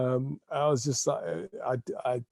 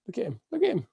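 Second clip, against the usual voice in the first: puppy voice; 10 semitones higher, faster than usual. Real, not acted. Cooing at the puppy.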